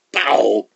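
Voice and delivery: sudden imitated smack; slap sound. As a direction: mouth the smack of swatting a mosquito. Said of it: "gets an honest answer".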